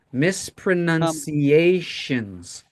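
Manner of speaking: mispronunciations drawn out syllable by syllable; slow and careful. Shown as a demonstration. Teacher modelling a word for the class, slow and careful. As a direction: stretch mispronunciations so each syllable is heard. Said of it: "that's a soft sound".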